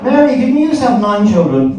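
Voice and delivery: high-pitched acted curiosity; mock-puzzled neighbour's question; nosy neighbour.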